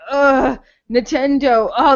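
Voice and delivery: usual speaking voice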